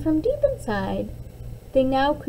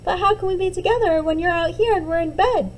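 put-on higher, quick puzzled child's question; curious little girl; 7 semitones higher, 3 dB louder, faster than usual. Little Liza puzzles over Mom's words, quick and high. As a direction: rush the question like an eager small girl.

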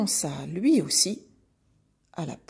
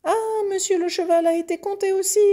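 fast, very high, loud calf exclamation; calf crying out; 12 semitones higher, 6 dB louder, faster than usual. A narrator's reading. Calf seeing the horse counted too, high hurried cry. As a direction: shriek it quickly as an alarmed calf. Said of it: "s'écria le veau".

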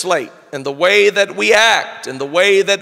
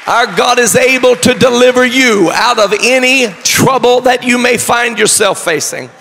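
raised, ringing sermon delivery; full-force preaching; 4 semitones higher, 3 dB louder than usual. Real, not acted. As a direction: proclaim it to the whole congregation.

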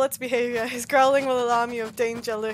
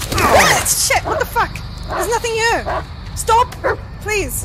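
frantic scared shouts; panicked yelling; 8 semitones higher, 6 dB louder, slower than usual. Real, not acted. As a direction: yell in real panic. Panicking alone in dark forest.

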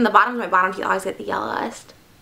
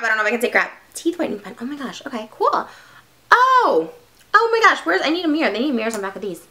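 ordinary talk, nothing marked

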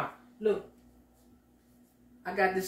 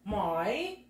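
ordinary delivery

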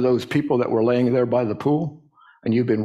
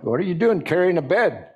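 higher-pitched scolding, only mildly raised; mock yelling; 6 semitones higher than usual. Religious critics scolding the man carrying his bed, mock outrage. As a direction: tell him off in disbelief.

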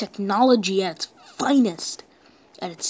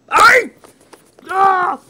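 frustrated yell; 10 semitones higher, 11 dB louder than usual. Real, not acted.

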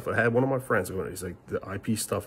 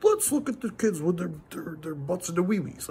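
much higher mocking voice for a creep he's not; 10 semitones higher than usual. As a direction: lampoon the accusation in a silly put-on voice. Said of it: "we're not trying to go out there and be like".

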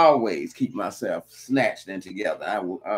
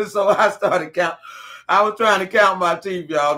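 laughing through the words, louder and higher; giggling, a bit louder, telling the teeth story; laughing hard; 7 semitones higher, 3 dB louder than usual. Real, not acted. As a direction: crack up while telling it. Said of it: "I ain't mean to go hard that twice".

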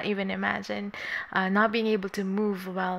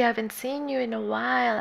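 usual speaking voice

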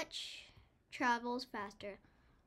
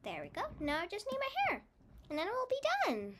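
usual speaking voice

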